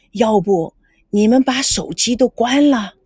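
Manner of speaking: ordinary delivery, nothing marked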